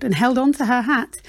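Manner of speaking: ordinary delivery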